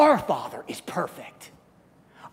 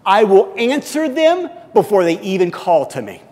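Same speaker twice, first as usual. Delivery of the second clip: loud, proclaiming Bible reading; scripture reading; 3 semitones higher, 8 dB louder than usual. Acted. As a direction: declare the verses to the room. Reads Isaiah verse to congregation.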